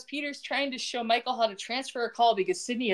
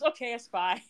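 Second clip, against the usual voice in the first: mock conceding; 3 dB quieter than usual. Man conceding to the woman he's hiring, understated resignation. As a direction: drop the drama and concede the word. Retold reply giving in.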